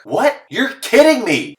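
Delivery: high, drawn-out kidding; stunned disbelief. Real, not acted. Hearing the leader was disqualified, high stunned disbelief. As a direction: gasp out the disbelief. Shocked reaction to the story.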